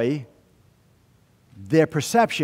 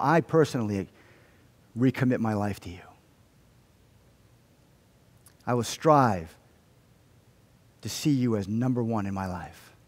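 earnest prayer; 3 semitones lower than usual. Real, not acted. Pastor praying for himself and his faith family, earnest and subdued. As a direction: confess and recommit humbly, with long pauses.

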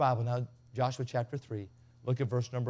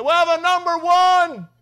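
mock shout, much higher and slow; yelling at the drive-thru; 15 dB louder, slower than usual. Shown as a demonstration. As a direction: bellow the order at the drive-thru speaker. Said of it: "this is how, this is how he does it".

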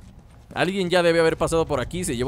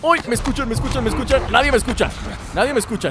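panicky; 5 semitones higher, faster than usual. Real, not acted. Clickers hear him, panic.

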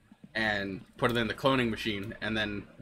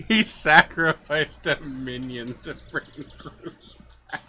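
slow, louder, deliberately grand delivery of "sacrificed"; mock dramatic announcement; 4 semitones higher, 6 dB louder, slower than usual. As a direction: intone it like a solemn epic line. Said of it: "I enjoyed that a bit too much".

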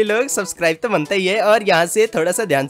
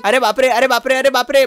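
high, loud repeated oh-my-god; alarmed cry; 6 semitones higher, 4 dB louder than usual. Pretend. Passenger panicking at the stunt, high and loud. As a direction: yelp it in a panic. Bike stunt nearly fails.

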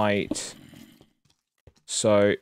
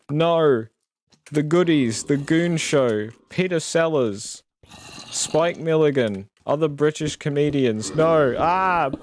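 higher and louder mock struggle; hammed-up British possession; 6 semitones higher, 5 dB louder than usual. Streamer possessed by British comedians, loud mock panic. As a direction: cry out the names as if possessed. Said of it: "possessed by a British person".

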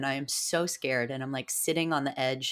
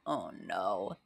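low, softer sinking oh no; mock dread; 7 semitones lower, 5 dB quieter than usual. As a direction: drop the pitch in playful dismay. Retold dread at being filmed.

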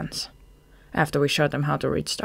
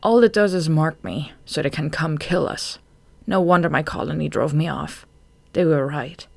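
usual speaking voice

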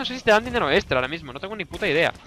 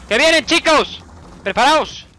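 higher, louder alarm to the team; loud warning; 7 semitones higher, 4 dB louder, slower than usual. Real, not acted. Player warning his crew of the assault, loud alarmed call. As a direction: shout a warning that they're coming.

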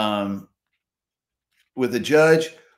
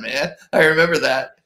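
ordinary delivery

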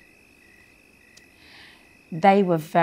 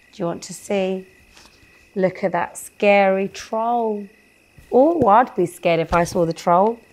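usual speaking voice

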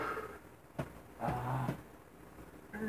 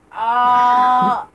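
pain yell; 6 semitones higher, 11 dB louder than usual. Real, not acted. Pain while stripping face.

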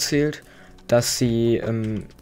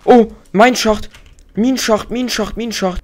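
much higher and louder, thrilled yelling at the find; excited shout; 11 semitones higher, 9 dB louder than usual. Real, not acted. Gamer spotting a mineshaft yells to viewers, excited. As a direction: shout it out like you just struck gold.

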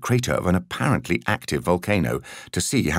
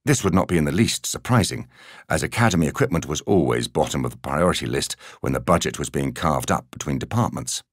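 ordinary delivery

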